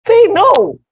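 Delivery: high, loud burst of exasperation; frustrated outburst. Real, not acted. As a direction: let frustration erupt, pitch leaping up. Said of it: "my frustration".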